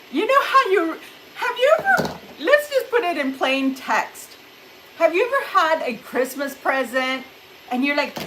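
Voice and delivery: ordinary delivery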